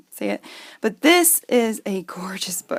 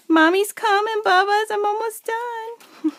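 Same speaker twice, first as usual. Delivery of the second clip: calling her son from across the house, high and loud; 11 semitones higher, 7 dB louder than usual. Real, not acted. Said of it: "I'm yelling in your ear".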